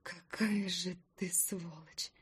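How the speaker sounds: slow, voiceless, seething whisper; furious whisper. Acted. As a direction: spit the insult out under breath. Wife hisses an insult at husband. (яростный шёпот)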